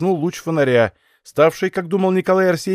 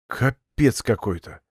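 lightly hoarse, slow and softer; hoarse, sunken voice; 4 semitones lower, 5 dB quieter, slower than usual. Acted. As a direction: say it as if your voice just gave out. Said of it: "севшим голосом проговорил".

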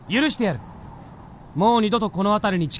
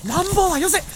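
flustered alarm; 11 semitones higher than usual. Acted. Fox startled by gun-wielding boy.

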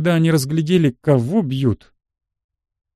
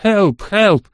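high, louder cry for help; panicked shout, moderately acted; yelling for help; 6 semitones higher, 4 dB louder than usual. A narrator's reading. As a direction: yell it in two short bursts. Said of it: "он заорал".

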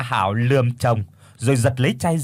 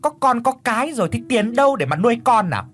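high, fast, shrill shrieking harangue; loud wailing tirade; 9 semitones higher than usual. Acted. Mother rants at the poor suitor, high and fast. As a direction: make a fuss, rant at him. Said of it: "bù lu bù loa lên".

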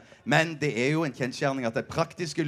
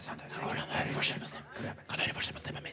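voiceless whisper that stays soft even when asked for more; 12 dB quieter, faster than usual. Shown as a demonstration. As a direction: ask it in a pure whisper. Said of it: "Kan du hviske litt høyere?". (hvisker uten stemme)

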